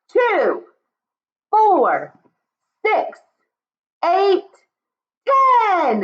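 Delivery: high, bright count-along; high cheerful counting. Shown as a demonstration. Teacher leads kids counting, high and lively. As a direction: call the numbers up high for kids.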